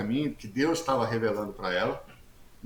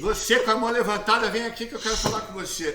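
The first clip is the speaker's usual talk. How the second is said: high-pitched imitation of a woman pastor; pastora convocando; 8 semitones higher than usual. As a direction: summon someone forward in a raised-pitch mock voice. Pastor's summons acted out. (imitando a pastora, voz aguda)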